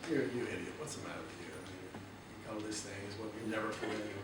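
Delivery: low, soft sneering inner critic; low scornful voice of self-doubt; critical inner voice. Retold or imitated. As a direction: mutter it like the nagging voice in your head. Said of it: "It's gonna usually go like".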